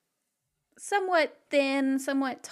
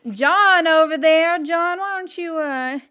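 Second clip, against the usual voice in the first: calling John; 6 semitones higher, 7 dB louder than usual. Acted. Fran calls John over.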